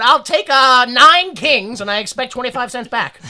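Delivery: loud, brash fifties phone customer; fifties gent at phone. Acted. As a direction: bark the order like an old-movie tough guy. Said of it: "he went".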